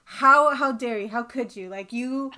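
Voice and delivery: hammed-up pacing rant; mock outrage. Pacing the house ranting at the writer, loud mock anger. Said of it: "Just like".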